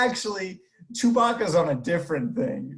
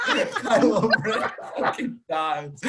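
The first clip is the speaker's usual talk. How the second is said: laughing; 4 semitones higher, slower than usual. Real, not acted. Laughing through the punchline.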